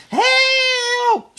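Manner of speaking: very high, very loud terrified scream. A narrator's reading. Little sister waking from nightmare, terrified scream. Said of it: "she screamed".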